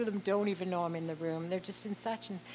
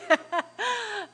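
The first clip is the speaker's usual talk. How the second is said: high embarrassed giggle; high laugh; 13 semitones higher, 7 dB louder than usual. Real, not acted. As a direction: giggle on at yourself. Laughs on at herself.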